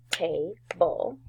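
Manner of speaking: "table" said as two beats, ta, then ble; split into syllables. Shown as a demonstration. Teacher preparing the class to spell, "table" split as ta... ble. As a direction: separate the two halves of "table". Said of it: "our syllable ul".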